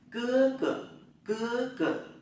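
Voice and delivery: cưa cửa said very high, cưa falling hard; Chinese tone drill. Shown as a demonstration. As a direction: demo cưa cửa with a sharp falling first syllable. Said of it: "Chúng ta tập lại".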